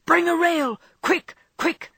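high, loud urgent call; frantic shout to a friend; urgent cry. Acted. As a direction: yell for help across the ice. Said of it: "Laurie's voice cried out".

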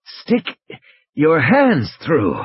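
slow, halting words with a breathy start; injured man wheezing his suggestion; weak wheeze. A narrator's reading. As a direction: labour each word out weakly. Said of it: "almost a wheeze".

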